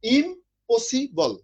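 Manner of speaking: Impossible said very slowly, split into im-po-si-bol; syllable by syllable. Shown as a demonstration. Teacher splits the word for his students, slow syllable by syllable. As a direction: stretch impossible out slowly, beat by beat. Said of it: "যেটা তিনবারে উচ্চারণ করতে হয়".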